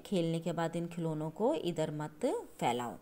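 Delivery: normal talking voice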